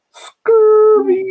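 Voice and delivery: high squeaky startled cry; scared yelp. Retold or imitated. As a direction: yelp it like you just got spooked.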